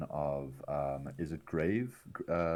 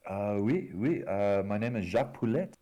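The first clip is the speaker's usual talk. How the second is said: higher, louder bad French accent; terrible French accent; 4 semitones higher, 5 dB louder than usual. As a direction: introduce yourself in a hammy French accent. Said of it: "in a terrible French accent".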